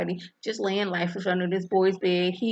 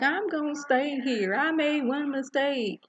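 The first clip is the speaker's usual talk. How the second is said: higher, pleading confession; high pleading wife; 6 semitones higher than usual. Retold or imitated. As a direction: plead your mistake up high. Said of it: "And she's like".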